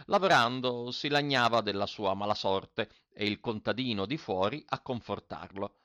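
plain reading voice

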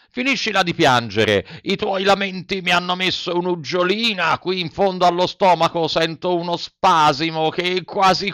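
a hint of a grumpy giant shouting; gruff puppet master. A narrator's reading. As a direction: scold the crying puppet with mock gruffness. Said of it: "gridò a Pinocchio".

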